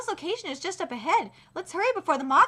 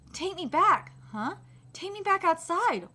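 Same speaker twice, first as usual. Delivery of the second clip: ordinary delivery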